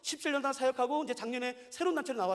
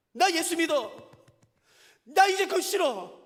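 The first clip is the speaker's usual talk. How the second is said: slow, loud, higher declaration; bold declaration; 5 semitones higher, 6 dB louder, slower than usual. Shown as a demonstration. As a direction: proclaim it proudly and slowly. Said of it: "라고 누가 좀 말씀하시겠습니까".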